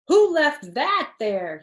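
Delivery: loud, high, slowed cry of surprise; startled cry. Acted. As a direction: yelp it out as he trips. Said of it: "he cried".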